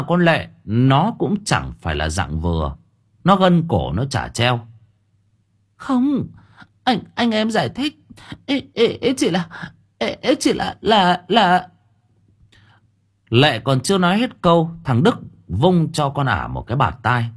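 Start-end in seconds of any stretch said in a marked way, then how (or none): 5.8-11.7 high, halting, panicky excuses; stammering wife. A narrator's reading. Wife to angry husband, high stammering plea. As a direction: trip over every word pleading.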